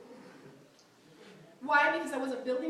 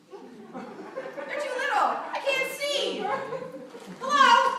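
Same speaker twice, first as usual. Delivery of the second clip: much higher, slow, exasperated complaint; 8 semitones higher, 5 dB louder, much slower than usual. Real, not acted. Preacher complaining to the congregation about tiny smileys, exasperated. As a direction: squint at a tiny emoji in disbelief.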